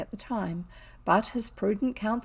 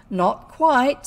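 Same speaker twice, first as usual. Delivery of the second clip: loud, cold, bitter reply; bitter smile; 4 semitones higher, 9 dB louder than usual. A narrator's reading. The magistrate rebuffs Oswald with a bitter smile. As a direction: cut him off with a bitter smile. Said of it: "with what I think you call a bitter smile".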